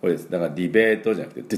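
normal talking voice

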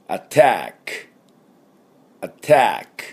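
teacher modelling attack; model English attack. Shown as a demonstration. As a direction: say アタック the English way, stress on the second syllable. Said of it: "襲撃のことはなんて書いてあったかっていうと".

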